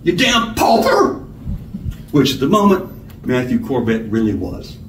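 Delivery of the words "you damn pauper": high, tearful snarl; angry high-pitched outburst; sodden snarl. A narrator's reading. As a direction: snarl it through the end of sobbing. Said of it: "sobbing became a sodden snarl".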